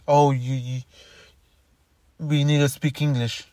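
ordinary talk, nothing marked

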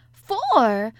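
loud, high echo of disbelief; astonished cry. A narrator's reading. Maid amazed at young mistress's question, astonished cry. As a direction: cry it back, incredulous. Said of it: "cried Martha".

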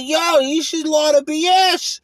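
high, loud New York pizza-guy voice; mock Brooklyn pizzeria guy. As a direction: ham up a Brooklyn pizza owner.

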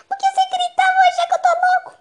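loud, high frantic yell; high shout. Acted. Yelling back in panic.

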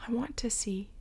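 soft longing whisper; whispered plea. Acted. The blind man answering Jesus in a whisper. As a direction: breathe the wish.

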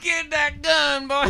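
put-on slow, high old-timer drawl; creaky old coot giving orders; old prospector. Granddaddy voice bit continues.

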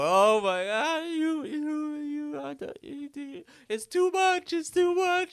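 high, wailing mock sob; imitating the aunt's hammed-up fake crying. Aunt pretending to cry at the mom, mock wailing very high. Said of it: "she starts fake crying".

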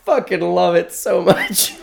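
high, slow laughing delight. Real, not acted. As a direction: giggle it out.

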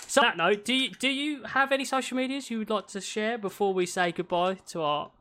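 ordinary talk, nothing marked

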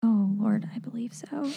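whispered to herself, unsure; whispering. Real, not acted. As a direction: whisper it while thinking.

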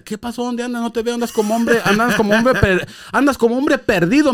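a curious buddy who is a bit worried; puzzled friend. Retold or imitated. Friend at the retreat asking where he has been, in a lightly voiced puzzled tone.

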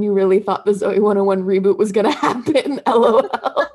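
loud, breaking into laughter; laughing while quoting. Real, not acted. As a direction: crack up as you finish the quote. Cracking up quoting a funny TikTok.

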